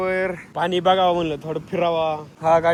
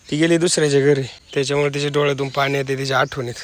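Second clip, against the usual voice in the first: normal talking voice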